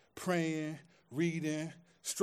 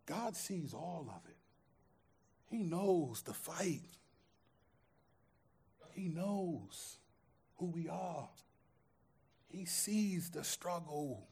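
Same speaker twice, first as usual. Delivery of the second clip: quiet preaching; 2 semitones lower, 8 dB quieter than usual. Acted. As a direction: draw them in with a hushed confession. Quiet intimate preaching stretch.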